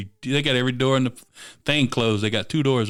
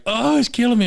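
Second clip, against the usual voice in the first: high mocking whine; mock the complainers, pitched way up; whiny complaint; 12 semitones higher, 5 dB louder than usual. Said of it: "everybody's like".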